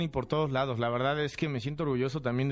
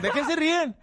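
much higher, louder gruff boxer impression; Julio César Chávez voice; 6 dB louder than usual. Asking the laughing crew, in the boxer's gruff voice. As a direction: bark it like the champ. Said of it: "Cuando yo empecé a hacer la voz".

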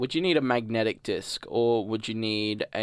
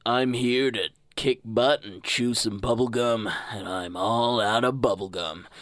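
awesome voice; 2 semitones lower than usual. Pretend. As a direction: deliver it as an epic action one-liner. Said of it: "say in my awesome voice".

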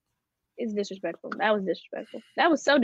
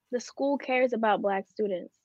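ordinary talk

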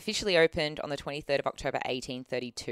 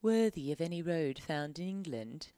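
Downton Abbey lady; 2 semitones higher, 4 dB quieter than usual. Retold or imitated. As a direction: speak like an aristocratic Edwardian lady. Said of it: "Downton Abbey lady".

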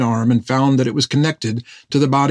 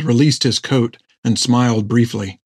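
plain reading voice

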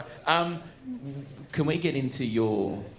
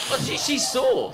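excited shout; 12 semitones higher, slower than usual. Real, not acted. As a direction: blurt it out excitedly. Excited overlapping.

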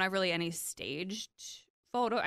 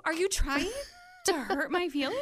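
imitating a high, weepy complaint; retold tearful protest; 10 semitones higher than usual. Bride retelling her answer to the photographer, mock-tearful protest. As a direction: act out being tearfully wounded. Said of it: "because she kept saying".

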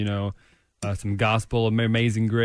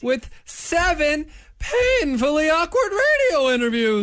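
hammed-up diary reading, higher pitched; diary read-aloud; 5 dB louder than usual. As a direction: build theatrically to the punchline. Reads Mad Lib diary aloud.

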